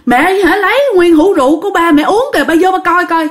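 high-pitched, urgent retold plea; acted frightened child's call, pitched high; alarmed calling for father. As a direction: call Dad to come and look, in alarm. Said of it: "đi ra bạn kêu".

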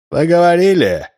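booming, high, loud megaphone voice; through a megaphone. A narrator's reading. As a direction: boom it through a loudhailer. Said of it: "раздался громовой голос". (громовой голос в мегафон)

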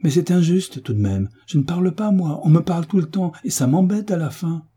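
put-on high, angry girl's outburst; an indignant schoolgirl snapping at the teacher. A narrator's reading. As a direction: protest hotly. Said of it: "dit-elle avec colère".

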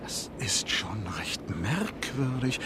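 slow whispered wonder; puzzled whisper. Acted. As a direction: mutter it to yourself slowly. Conductor puzzled in empty compartment.

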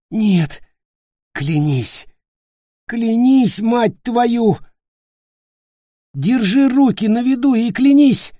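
high, loud, furious insistence; furious demand. Acted. Dying Nick furiously demanding Peter swear. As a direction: force the oath out of him.

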